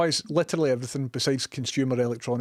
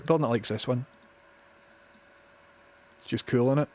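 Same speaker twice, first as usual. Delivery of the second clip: ordinary delivery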